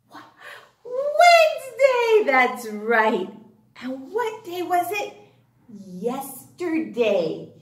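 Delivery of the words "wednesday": high, loud cheer of the day name; excited answer. Acted. Teacher cheering the class answer, high and loud. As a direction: sing out the answer to the kids.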